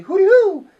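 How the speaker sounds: yelled warning code word, hooted high; code call. Retold or imitated. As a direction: call it out like a signal. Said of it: "you say".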